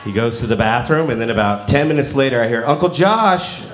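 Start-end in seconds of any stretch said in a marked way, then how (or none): 2.7-3.6 higher imitation of a child calling out. Little nephew calling his uncle from the bathroom, imitated calling out. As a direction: call for help from another room.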